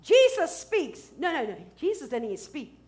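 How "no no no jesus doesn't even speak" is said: normal talking voice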